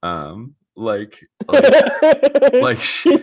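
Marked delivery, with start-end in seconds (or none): 1.5-3.2 laughing. Real, not acted. Laughing while describing April.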